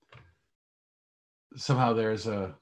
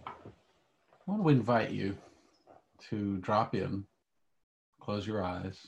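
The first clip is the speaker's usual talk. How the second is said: calm grounding exercise; 4 dB quieter than usual. Acted. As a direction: guide them gently into stillness. Leads a guided meditation.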